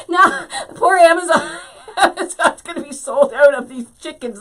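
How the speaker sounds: loud, high laughing through the words; cracking up while talking. Real, not acted. Crafter laughs telling viewers Amazon will sell out, laughing loudly.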